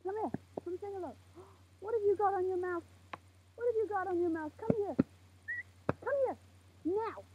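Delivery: high, soft coaxing call; calling over. Real, not acted. Calling someone over before filming, high and coaxing. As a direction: summon them in a high, hushed voice.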